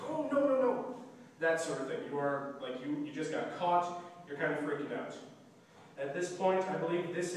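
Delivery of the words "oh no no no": big denial. Pretend. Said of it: "we want big gestures".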